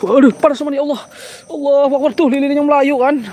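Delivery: high, loud alarmed exclamations; high startled yelps of alarm; startled excitement. Real, not acted. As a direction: cry out as the flame jumps. Reacting to candle flaring.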